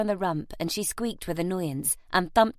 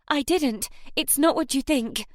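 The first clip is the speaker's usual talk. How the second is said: stammering; 6 semitones higher than usual. Acted. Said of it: "Nell stammered".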